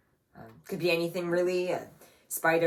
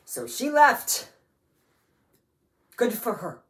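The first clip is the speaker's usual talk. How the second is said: angry and wounded, raised voice; bitter outburst; 6 semitones higher, 7 dB louder than usual. Acted. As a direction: spit out the hurt, then sarcastic approval. Bitter about mother abandoning family.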